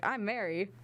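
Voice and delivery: polished on-air name intro; radio voice. Shown as a demonstration. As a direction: say your name like a radio host. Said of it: "my quote-unquote radio voice when I say my name".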